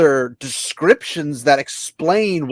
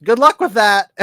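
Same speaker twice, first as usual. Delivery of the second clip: flippant; 8 semitones higher, 6 dB louder than usual. Retold or imitated. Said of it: "shoot it into the air and say".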